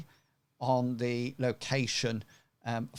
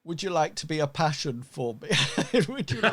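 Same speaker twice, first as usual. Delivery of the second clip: giggling through the words, pitched higher; laughing; 4 semitones higher, 3 dB louder, faster than usual. Real, not acted. GM cracks up mid-line while voicing the captive, laughing. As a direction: laugh while trying to get it out.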